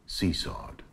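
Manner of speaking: 숨소리로 속삭이듯 낮게 'Seesawed'; whispered word. Acted. Lone word before repeated line.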